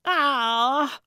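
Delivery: loud, high startled scream. A narrator's reading. Gertrude finds Calvin inches away, startled scream. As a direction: scream in sudden fright. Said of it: "she screamed".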